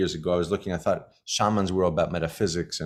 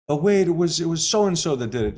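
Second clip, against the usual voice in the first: mock protest; 7 semitones higher than usual. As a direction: play the one blaming someone else. Voicing a blaming objection.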